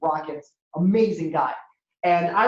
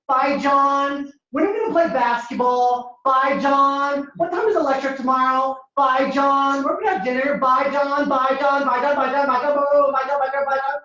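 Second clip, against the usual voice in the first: higher, insistent little-brother pestering; pestering younger brother; 7 semitones higher than usual. Acted. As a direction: call for big brother over and over, higher and relentless. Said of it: "the younger brother, he talks to his brother".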